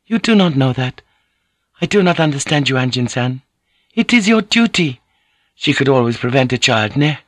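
insistent, formal lady instructing him; earnest Japanese lady. Acted. Voices Mariko in the dialogue.

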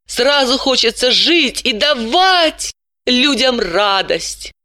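high, teasing and admiring; playful woman's inner voice. A narrator's reading. Dalila thinking about the stranger, playfully admiring. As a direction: coo over the charming stranger.

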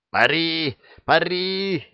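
high, strained bellow; straining yell. A narrator's reading. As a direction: yell yourself hoarse. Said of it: "надсаживаясь, орал".

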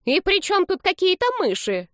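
very high indignant question; cross old-lady voice, pitched high; angry granny. A narrator's reading. Elderly woman mishears and gets cross, high voice. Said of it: "рассердилась пожилая женщина".

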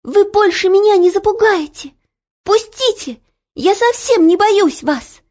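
put-on high girlish shout of defiance; defiant cry. A narrator's reading. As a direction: shout back, unafraid. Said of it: "закричала Ело".

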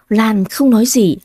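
plain reading voice

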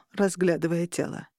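usual speaking voice

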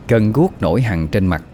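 usual speaking voice

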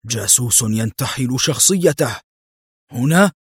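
dazed echo to oneself, lifting on "هنا"; stunned. A narrator's reading. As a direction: mull over the news in mild disbelief. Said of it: "ووضع سماعة الهاتف في ذهول". (ترديد للنفس بذهول)

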